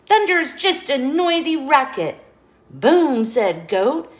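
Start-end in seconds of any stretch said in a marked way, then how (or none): 0.1-2.2 higher, louder quacky declaration; loud duck. A narrator's reading. Duck telling everyone off about the thunder, loud. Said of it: "loud as he could quack it". 2.7-3.2 loud, higher boom; mock thunder boom. A narrator's reading. Goat pretending to be thunder, loud boom.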